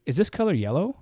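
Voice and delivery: YELLOW lifted high at the end; questioning tone. Shown as a demonstration. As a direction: ask it, voice rising on "yellow". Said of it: "that is a question".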